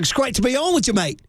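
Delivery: mock Australian accent, pitched high. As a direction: imitate a chummy Australian greeting. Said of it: "they had the, the Australian accent".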